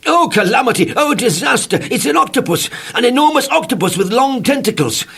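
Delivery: high, loud panicked wail; cried in terror. A narrator's reading. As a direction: shriek it like you're being squeezed to death. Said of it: "I cried in terror".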